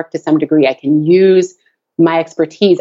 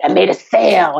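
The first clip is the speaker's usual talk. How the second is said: growly voice. Shown as a demonstration. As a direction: growl it out for the kids. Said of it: "she likes this really growly voice".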